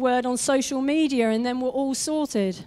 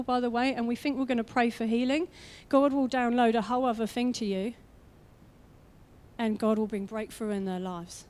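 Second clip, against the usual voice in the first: ordinary talk, nothing marked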